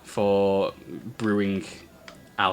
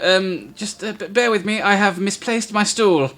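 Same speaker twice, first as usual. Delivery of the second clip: flustered halfling; 10 semitones higher, 3 dB louder than usual. Acted.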